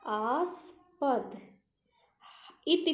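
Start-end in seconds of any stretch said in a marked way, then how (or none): 0.1-1.4 आस्पद very slowly, split as 'aas... pad'; spelling demonstration. Shown as a demonstration. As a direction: draw out both halves of आस्पद. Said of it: "कैसे?". (आस्पद धीरे, तोड़कर)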